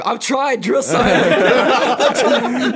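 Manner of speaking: high, loud retold plea to the drill sergeant; mock panicked plea. Recruit begging the drill sergeant for patience, mock panicked.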